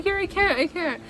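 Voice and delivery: high-pitched imitation of a panicked cousin; mock scared. Scared cousin handing over the phone mid-call, mock panic. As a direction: squeal it like you're too scared to talk. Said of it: "She got so scared".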